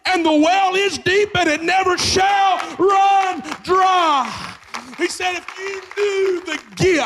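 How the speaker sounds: high, loud, shouted church preaching; full-force preaching. Real, not acted. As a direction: preach it out to the congregation, ringing and loud.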